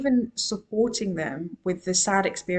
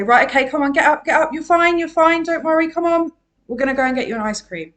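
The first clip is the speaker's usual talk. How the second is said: higher, louder brisk mum voice; mum hurrying child along; 7 semitones higher, 6 dB louder, faster than usual. Retold or imitated. Mum brushing off a crying child, brisk and cheery. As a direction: chivvy the upset kid up off the ground. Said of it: "Our mums would say".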